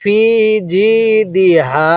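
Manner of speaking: ফি and জি drawn out, then দি হা; measured ফি জি দি হা with pauses between; slow model reading. Shown as a demonstration. Teacher showing how to read, stretched ফি and জি. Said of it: "আমার সাথে পড়ুন".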